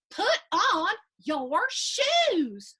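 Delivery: slow, loud, high motherly order; stern mom. Acted. Mom ordering dragon Melvin to put on his shoes, slow and loud. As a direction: spell it out like a mom. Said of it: "Mom says".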